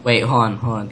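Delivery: ordinary talk, nothing marked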